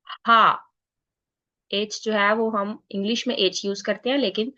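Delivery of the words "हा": हा with an open breathy a; German H. Shown as a demonstration. Teacher names German H for learners, saying हा openly. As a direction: say हा, not एच. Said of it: "this is हा".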